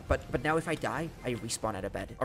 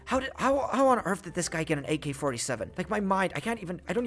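high, breathless excitement; can barely contain the thrill; overexcited; 6 semitones higher than usual. Real, not acted. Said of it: "I can't even talk at this moment".

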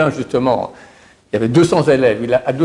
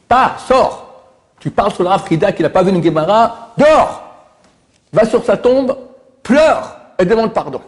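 furious rebuke; 8 semitones higher than usual. Acted. Baba Saleh ordering the scholar to his rival's grave, furious shout. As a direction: throw him out with a roar of anger.

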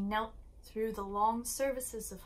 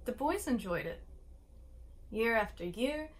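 plain reading voice

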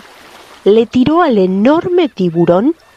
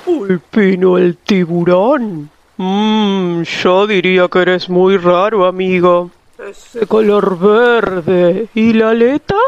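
sly shark voice. A narrator's reading. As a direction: toy with the prey. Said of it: "con una boca llena de filosos dientes le dijo".

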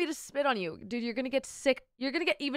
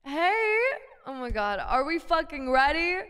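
normal talking voice

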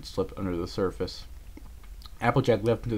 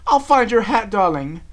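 high, loud promise; determined call; 12 semitones higher, 10 dB louder than usual. A narrator's reading. Rarity racing off for her friend's hat, calling out. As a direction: call it out while galloping away.

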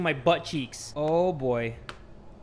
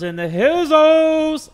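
loud, drawn-out goofy sing-out; silly odd delivery; 8 semitones higher, 8 dB louder, slower than usual. Real, not acted. Player remembering the open windows, silly drawn-out belt. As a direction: belt out the last word, holding it long. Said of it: "why I said it like that".

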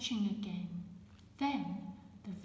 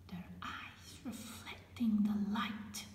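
hushed storytelling whisper; whispered reading. Acted. Reading the firefly story to children in a whisper. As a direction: breathe the words out, no voice.

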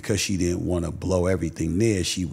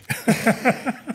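high-pitched laugh in his mother's voice; high mock laugh. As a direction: let out her giddy laugh. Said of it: "she would have been like".

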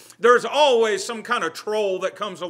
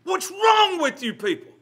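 higher-pitched exasperated retelling of the outburst; mock exasperated cry; riled-up outburst; 10 semitones higher than usual. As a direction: repeat it climbing in pitch, fed up with the crowd. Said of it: "got really riled up".

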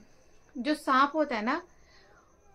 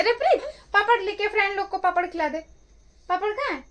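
calling out; 7 semitones higher, 4 dB louder, faster than usual. Real, not acted. Calls out to Preet for papad.

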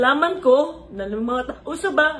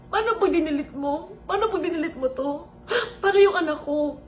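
crying; 4 semitones higher than usual. Real, not acted. Tearful confession about editor.

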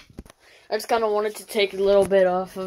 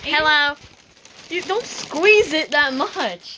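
helium voice; 5 semitones higher, slower than usual. Shown as a demonstration. After sucking helium balloon.

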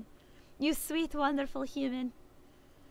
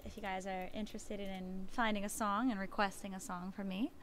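ordinary talk

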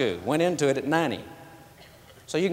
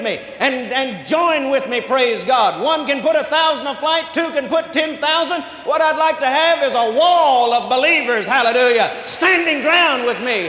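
loud, high, ringing preacher's call; full-force preaching; 10 semitones higher, 6 dB louder than usual. Acted. Preacher rallying the congregation for believers, in a loud, high call.

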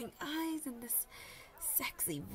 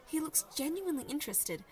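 ordinary delivery

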